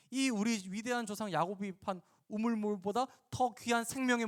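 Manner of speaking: snapping back. Acted. Samaritan woman challenges the teacher about the well, snapping back. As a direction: talk back with incredulous questions. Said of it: "또 쏘아붙이죠".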